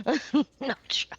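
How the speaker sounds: giggling through the words; laughing repeat. Real, not acted.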